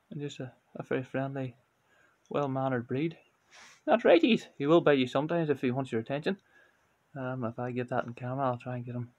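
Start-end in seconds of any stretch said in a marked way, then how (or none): 3.8-4.5 high pet-talk voice. Acted. Cooing to his cat.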